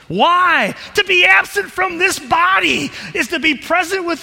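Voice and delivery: loud, high, triumphant shout; preaching shout. Acted. Preacher proclaiming deliverance to the congregation, shouted. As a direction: proclaim it with joy. Preaching builds to full force.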